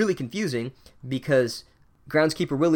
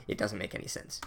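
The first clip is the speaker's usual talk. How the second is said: "It doesn't make any sense" said much softer and lower, trailing off; muttered aside; 4 semitones lower, 11 dB quieter than usual. Acted. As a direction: drop to a resigned under-breath aside. Repeats complaint, then drops voice.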